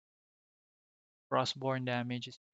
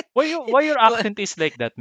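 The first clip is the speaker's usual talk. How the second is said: higher, louder mock caller voice, pushy; mock American caller; 10 semitones higher, 10 dB louder than usual. Mimicking a rude American caller to his laughing friend, hammed-up. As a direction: play a rude caller mocking an accent. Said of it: "sila yung sumunod sa accent ko".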